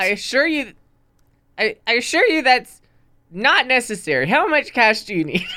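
Jenny placating. Acted.

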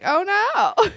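high, sweet mock nurse voice. Imitating the kind nurse fussing over patient, high and sweet. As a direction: coo it like a gentle nurse. Nice lady voiced in retold story.

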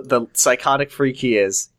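usual speaking voice

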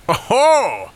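high, loud teasing oh-ho; a hearty old sailor's tease; amused exclamation. A narrator's reading.

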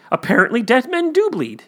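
high, quick punchline delivery; mock realization. Stubborn man in the fable drawing his conclusion, mock surprised. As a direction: play a man cheerfully revising his belief.

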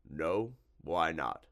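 doubting creature voice; lightly voiced monster, curious; slimy monster, puzzled. A narrator's reading.

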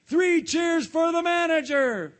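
slow, loud rallying cheer; rally the crowd. Acted. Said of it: "cried Mister Krabs".

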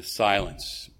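ordinary delivery

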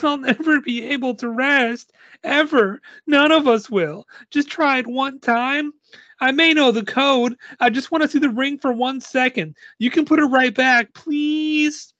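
very high, whiny mock crying; tearful little girl begging, acted in a high voice; starting to cry. A narrator's reading. Girl begging for one look at the ring, acted crying. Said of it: "She starts to cry".

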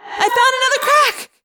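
very high, loud shout; excited call. Acted. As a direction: call it out to the group. Cassie calling out to friends.

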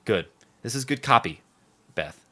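ordinary delivery

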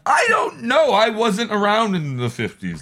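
high, slow, hammed-up protest; mock exasperated shout. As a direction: wail back in comic exasperation. Acting an exasperated reply to Pamela.

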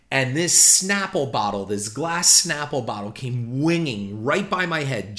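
ordinary talk

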